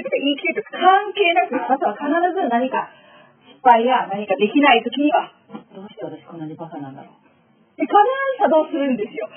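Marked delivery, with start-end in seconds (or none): 5.7-7.1 inner self-blame. Retold or imitated.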